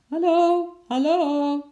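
high, drawn-out loud hallooing; calling out loudly. A narrator's reading. Lotje shut in the fish house, calling as loud as she can. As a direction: holler as hard as you can for help. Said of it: "riep Lotje zo hard als ze kon".

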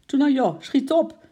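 loud, high urging; impatient call. A narrator's reading. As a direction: hurry someone along impatiently. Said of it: "riep Makkie ongeduldig". (ongeduldig roepend)

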